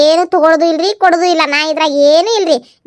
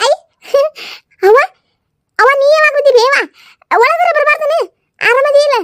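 higher, sweet-talking woman's voice; a higher voice for the daughter-in-law's mother; cheerful higher female character voice; second woman's voice; 10 semitones higher than usual. Acted. One actor plays a second woman.

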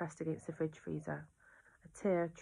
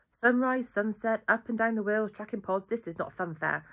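louder, higher, exasperated list; aunt losing patience with the police, angry; angry rant; 5 semitones higher, 8 dB louder than usual. Acted. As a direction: fling the words out, fed up. Aunt ranting at the inspector.

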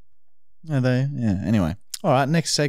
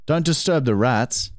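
usual speaking voice

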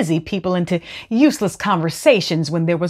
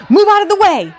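loud, high shout; yelling; 13 semitones higher, 5 dB louder, faster than usual. A narrator's reading. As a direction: bellow it at the crowd. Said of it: "yelled a man with a digging stick".

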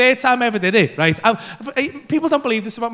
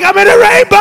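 high, loud cry of wonder; ecstatic shout; 8 semitones higher, 9 dB louder than usual. Retold or imitated. Teller flying with no body, ecstatic shout. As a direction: yell it out, elated, stretching the last word. Acts out ecstasy inside the rainbow.

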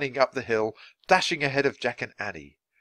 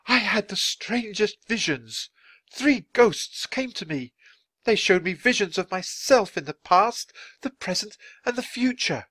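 shaken wonder; 12 semitones higher than usual. A narrator's reading. Writer telling children of three ghosts, shaken. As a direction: recount it in lingering awe. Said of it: "His voice was shaking".

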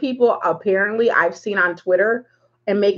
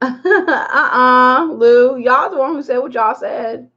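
cracking up, uh-uh; laughing protest; 4 semitones higher, 3 dB louder, slower than usual. Real, not acted. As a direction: laugh through the denial. Reacting to a friend's tease.

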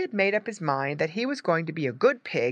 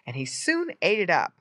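usual speaking voice

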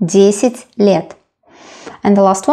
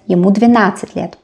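"двенадцать" run together quickly; fast, connected phrase with "двенадцать" unbroken; natural-speed repeat; faster than usual. Shown as a demonstration. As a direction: say "Ему двенадцать лет" in one brisk flow.

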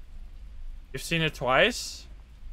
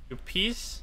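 ordinary talk, nothing marked

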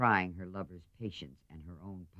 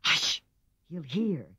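urgent hush; 7 semitones higher, 4 dB louder, slower than usual. A narrator's reading. Meg hushing her aunt.

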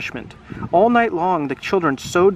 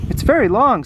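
higher, louder exclaiming; excited boy; 6 semitones higher, 5 dB louder than usual. Acted. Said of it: "said Alex".

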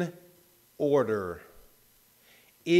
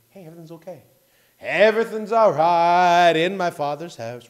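mock breezy; 11 dB louder than usual. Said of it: "Peace isn't".

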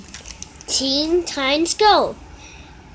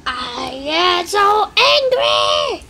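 mock angry shout; 2 semitones higher, slower than usual. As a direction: stretch it out like a furious cartoon hero. Toy character declares anger.

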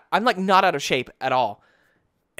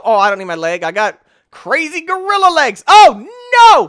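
normal talking voice

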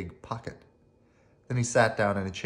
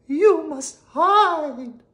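high, very slow, louder warning; urgent harp; 10 dB louder, much slower than usual. Acted. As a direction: warn them drawn out and high. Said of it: "cried the Golden Harp".

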